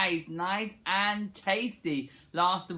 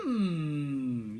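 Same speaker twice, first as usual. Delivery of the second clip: low contented hum; tasty mm; 3 semitones lower than usual. Shown as a demonstration. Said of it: "rubbing your tummy and you go".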